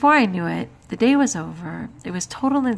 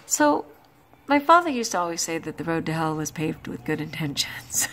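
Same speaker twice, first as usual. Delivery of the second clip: normal talking voice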